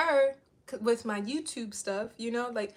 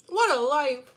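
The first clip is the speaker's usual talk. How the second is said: laughing; 3 semitones higher, 8 dB louder, slower than usual. Real, not acted. Repeat after laughing.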